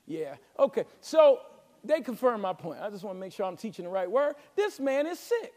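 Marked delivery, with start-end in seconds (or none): none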